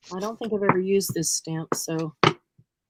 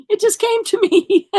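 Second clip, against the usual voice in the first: higher and louder through real laughter; laughing; 11 semitones higher, 8 dB louder than usual. Real, not acted. Explaining her craft choice to a friend, laughing. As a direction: laugh the answer out.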